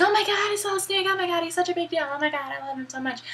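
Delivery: high-pitched starstruck gush, imitated; mock excited squeal of words; gushing fangirl. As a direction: gush like a hammed-up fan. Voicing a starstruck Meryl meeting Snake.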